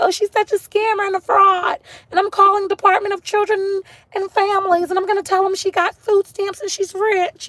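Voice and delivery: hammed-up scandalized critic yelling; mock accusing critic. Imitating viewers who would report her, outraged high shout. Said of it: "Then y'all gonna be like".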